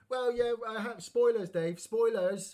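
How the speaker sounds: high, loud protest at spoilers; loud, high-pitched objection; exclaimed complaint. Real, not acted. Mock scolding Dave for spoilers.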